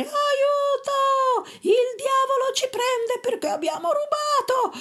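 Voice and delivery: very high panicked cries; terrified screaming. A narrator's reading. Thieves screaming as the door falls on them, high panic. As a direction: flee the devil in terror. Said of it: "cominciarono a urlare".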